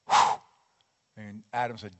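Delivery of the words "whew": short strong breath blown out, louder; breath of life. Shown as a demonstration. God breathing life into Adam, a strong blown breath. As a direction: puff the breath of life into clay. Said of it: "God lifted him up and went".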